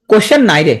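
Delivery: "কোশ্চেন নাই রে" like a little Bengali phrase; mnemonic misreading. Shown as a demonstration. Teacher showing how to read questionnaire, mnemonic pronunciation. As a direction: turn the ending into "নাই রে". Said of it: "পড়বা কি হবে".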